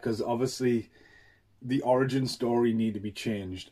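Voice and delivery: ordinary talk, nothing marked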